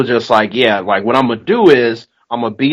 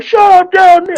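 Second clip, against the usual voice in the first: much higher, louder mock yell, fast and sharp; angry neighbors yelling; 6 dB louder than usual. Retelling neighbors yelling at him, mock yell. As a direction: snap it out like a woken neighbor. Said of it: "instead they were like".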